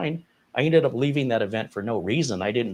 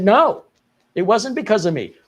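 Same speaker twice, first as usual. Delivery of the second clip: loud, high-pitched denial; indignant retold protest; 8 semitones higher, 7 dB louder than usual. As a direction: push back hard, voice up and louder. Retold protest against coworker blame.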